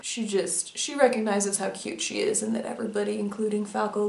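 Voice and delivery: usual speaking voice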